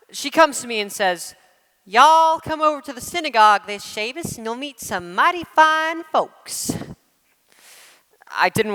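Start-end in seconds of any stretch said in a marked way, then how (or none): 1.9-6.7 a touch of a Southern belle; Southern accent. Retold or imitated. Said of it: "learn the dialect".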